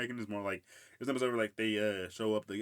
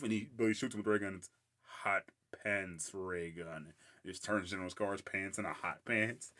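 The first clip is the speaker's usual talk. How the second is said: ordinary talk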